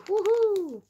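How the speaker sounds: high whoop of delight; bargain-win cheer; excited whoop. Real, not acted.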